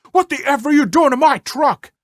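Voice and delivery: high, loud furious demand; loud high mock outrage; angry yell. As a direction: bark it out at a stranger by his truck. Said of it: "came charging out of his front door yelling".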